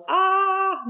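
long, held high "Aah" imitating a scream; mock loud scream. Nun retelling the woman's handstand in the mall, mock scream. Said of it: "schreit laut".

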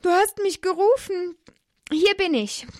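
put-on a child answering his master keenly; young Samuel. A narrator's reading.

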